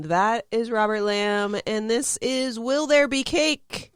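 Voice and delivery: slow, high show-title announcement; announcer voice. Acted. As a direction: present the show name with a flourish.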